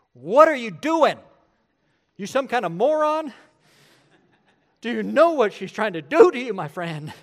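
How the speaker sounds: loud, high scolding on the phone; angry shout. Retold or imitated. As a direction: chew him out at full volume. Pastor berating protege on phone.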